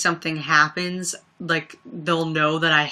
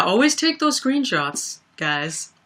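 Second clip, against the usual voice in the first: usual speaking voice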